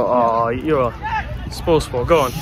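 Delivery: normal talking voice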